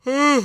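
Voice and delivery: a loud, high yawn breaks through the first word; big yawn. Real, not acted. Sleepy vlogger in a warm room, loud yawning. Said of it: "don't know why I'm yawning so much".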